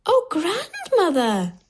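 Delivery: put-on high, drawn-out child exclamation; wondering little girl, high and slow. A narrator's reading. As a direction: exclaim slowly in a high girl's voice. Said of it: "she says".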